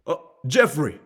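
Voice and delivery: loud, high, delighted greeting; buddy-style sales call. Shown as a demonstration. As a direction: hail the customer like an old pal. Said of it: "Or you can say".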